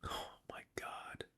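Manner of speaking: breathless awed whisper. Real, not acted. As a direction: whisper it in disbelief. Awed by the chart.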